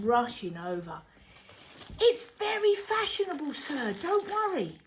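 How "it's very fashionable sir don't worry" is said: high, fast, fussy reassurance; boss voice. Acted. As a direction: gush quickly to calm him. Boss soothing customer in story.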